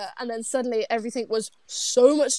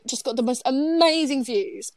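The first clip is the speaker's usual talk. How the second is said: usual speaking voice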